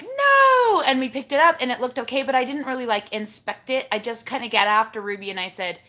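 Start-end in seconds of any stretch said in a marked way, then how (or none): 0.0-0.8 sudden high, loud cry of dismay; horrified no. Retold or imitated. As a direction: gasp it out as the mug falls. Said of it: "And I was like".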